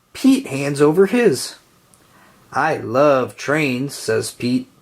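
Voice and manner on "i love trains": plain reading voice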